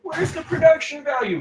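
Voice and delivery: very high, squeaky mock complaint; mock outcry. Co-host teases host about production, high mock outcry. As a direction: pipe up in exaggerated falsetto outrage. Mock heckling over the background noise.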